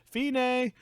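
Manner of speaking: high, loud villain calling through a door; taunting threat. Retold or imitated. Villain to princess behind the door, taunting threat.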